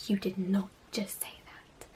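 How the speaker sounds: shocked private whisper; incredulous whisper. Retold or imitated. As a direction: hiss it in disbelief under your breath. Shocked inner reaction.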